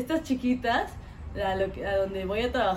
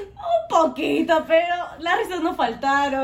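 slow, louder teasing voice; imitating friends; 5 semitones higher, 7 dB louder than usual. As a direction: drag it out teasingly. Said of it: "Y me dijeron". (imitando a los amigos, lento y burlón)

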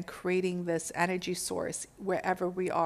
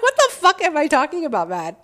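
incredulous; 8 semitones higher, 10 dB louder than usual. Retold or imitated. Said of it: "I'm like".

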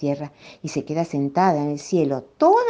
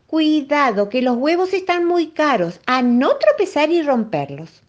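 higher, louder anxious mum; mother's warning; 9 semitones higher, 3 dB louder than usual. A narrator's reading. Mother warns Felipito not to break the costly eggs. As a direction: warn him sharply like a worried mother.

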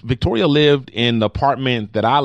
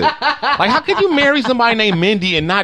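mock outrage; 11 semitones higher than usual. Host teasing Jason about his name, high mock outrage. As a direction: protest as if it's a scandal.

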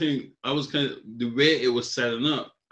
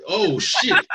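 a panicked laugh through the curse; 12 semitones higher, 5 dB louder than usual. Retold or imitated. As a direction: caught out, laughing and higher. Dad caught off guard.

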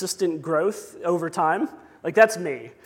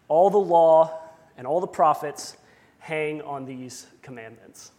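solemn, louder scripture reading, building to the commandments; 5 dB louder than usual. Acted. Pastor reads scripture to the congregation, solemn proclamation.

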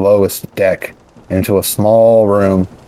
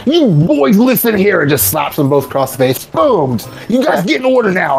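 much higher, sharp commanding shout; orc boss barking orders; 10 semitones higher than usual. Acted. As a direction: whip the two orcs into line. Orc boss scolds underlings.

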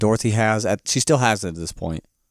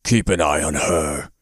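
low, slow booming-voice imitation; booming villain voice; 7 semitones lower than usual. Master ordering his spy to watch her, mock booming. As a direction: attempt a deep, commanding boom without raising volume. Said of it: "booming voice go".

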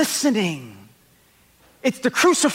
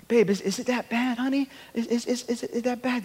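higher, softer coaxing of a spouse; minimizing; 4 semitones higher, 6 dB quieter than usual. Acted. Husband to his upset wife, minimizing. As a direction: gently play it down in a higher, softer voice. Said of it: "is the mode of minimizing".

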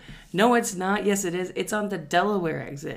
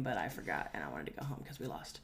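whispered aside; 12 semitones lower, 14 dB quieter than usual. Real, not acted. Confessing to a friend that he forgot, whispered. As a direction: whisper it as a throwaway. An aside about the lost game.

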